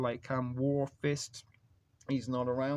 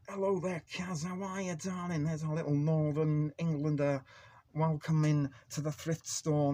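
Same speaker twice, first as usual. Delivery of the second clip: higher, louder put-on Manchester accent; Northern English accent; 4 semitones higher, 5 dB louder than usual. Greeting Kaz from the chat as she heads to work, in a put-on Manchester accent. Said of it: "That's my Northern accent".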